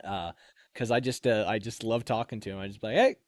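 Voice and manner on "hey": high, loud greeting broken by a laugh; playful shouted good morning; cheeky call. Retold or imitated. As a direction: hail him cheerfully, laughing.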